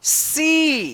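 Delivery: सी said with a long "ee"; model reading. Shown as a demonstration. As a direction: read सी, lifted and long. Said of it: "इसको क्या पढ़ेंगे?".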